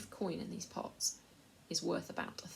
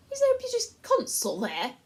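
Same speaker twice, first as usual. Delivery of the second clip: much higher, louder prissy schoolboy; prim Eustace; 12 dB louder than usual. A narrator's reading. Eustace demanding a British consul, prissy and high. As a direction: ask like a fussy know-all.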